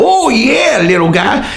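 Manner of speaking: high teasing taunt to a little guy; mock taunt. Taunting a tiny opponent in a fake fight, mock taunt. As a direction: tease him like a small child. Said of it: "I'll go".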